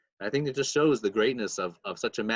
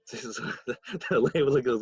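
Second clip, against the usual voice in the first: ordinary talk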